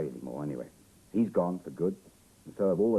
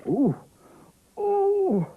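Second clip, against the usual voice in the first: loud, very high "ooh" wail; high creature cry; 10 dB louder than usual. Acted. Reader flies the terrified pteranodon into smoke, high cry.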